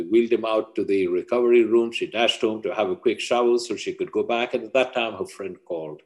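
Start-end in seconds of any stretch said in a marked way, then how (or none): none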